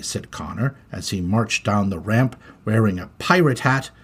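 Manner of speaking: ordinary delivery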